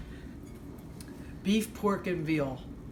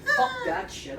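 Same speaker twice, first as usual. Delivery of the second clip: exasperated outburst. Pretend. Exasperated joke while cooking.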